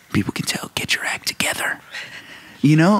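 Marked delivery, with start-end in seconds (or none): 0.0-0.1 inner critic whisper. Retold or imitated. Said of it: "I'm like". 0.1-1.8 soft, unvoiced whisper of doubt; whispered self-doubt. Pastor voicing the inner critic before preaching, whispered.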